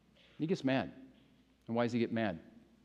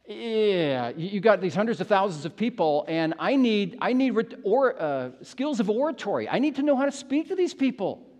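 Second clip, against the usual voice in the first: anxious protest; 7 semitones higher, 5 dB louder than usual. Acted. Preacher voices Moses's anxious protest.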